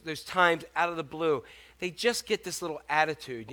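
ordinary talk

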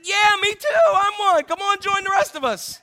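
high, loud welcoming confession; loud happy come-on; cheerful admission. Retold or imitated. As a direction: invite them in joyfully. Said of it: "I'm saying".

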